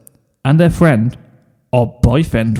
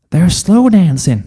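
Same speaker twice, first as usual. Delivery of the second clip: gushing filly; 6 semitones higher than usual. Acted. Sweetie Belle gushing.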